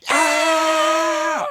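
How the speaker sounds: high, loud screaming yell; theme scream. Retold or imitated. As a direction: scream like the show intro.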